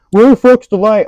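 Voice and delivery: high, loud retold yell of a coworker; a coworker yelling across the shop for the light; mock yell. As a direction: bark it like an annoyed coworker who can't find the light.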